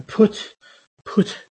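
"put" with a strong burst of air on the final T; aspirated put. Shown as a demonstration. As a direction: end "put" on a hard aspirated T. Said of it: "very strong lip".

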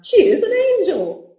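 very high, loud hammed-up raving; rapturous cry. Madman praising Evelina to Lord Orville, rapturous cry. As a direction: exclaim in swooping rapture. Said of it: "cried the madman".

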